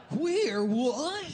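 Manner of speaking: high startled double take; mock confusion. Audience's brain reacting, high and confused. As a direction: react like the brain can't compute. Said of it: "your brain's like".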